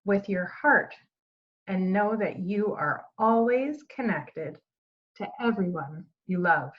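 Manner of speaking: gentle storybook read-aloud with lively dialogue; reading to children. Acted. Teacher reads the picture book to her students, storytelling voice. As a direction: read it like story time for a class.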